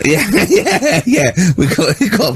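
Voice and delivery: laughing yes-yes. Real, not acted. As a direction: agree while laughing. Laughing agreement.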